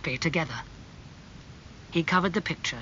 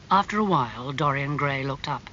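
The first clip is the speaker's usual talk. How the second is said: ordinary delivery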